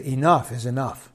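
soft, low "Enough is enough."; firm quiet declaration. Pretend. Pastor voicing what a believer should say, quiet and firm. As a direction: say it quietly with resolve. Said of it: "and say".

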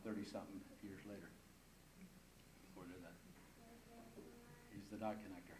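low, soft reverent testimony; quiet, low wonder; hushed awe. Real, not acted. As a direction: drop right down and let it sink in. Hushed awe closing his testimony.

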